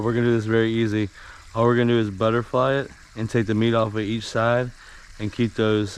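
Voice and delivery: ordinary delivery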